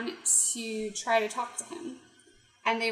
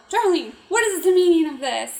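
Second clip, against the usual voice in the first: indignant cry; 6 semitones higher, 10 dB louder than usual. Acted. Pink-haired girl protests, acted.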